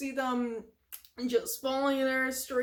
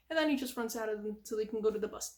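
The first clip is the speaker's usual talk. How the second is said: normal talking voice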